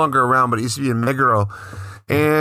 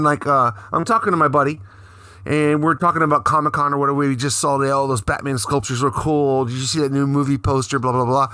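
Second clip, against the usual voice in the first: ordinary talk, nothing marked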